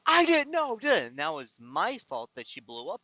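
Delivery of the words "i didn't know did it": high, loud yelled imitation; mock yelling. Man imitating the woman after her wrong directions, mock yelling. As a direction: yell it defensively, mimicking her outburst. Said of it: "she's yelling at me".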